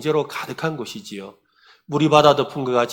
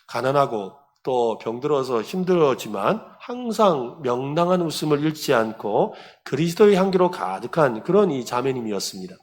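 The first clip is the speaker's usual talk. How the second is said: ordinary talk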